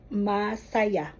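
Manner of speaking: "masaya" stretched slowly over its syllables. Shown as a demonstration. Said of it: "make it slowly so that".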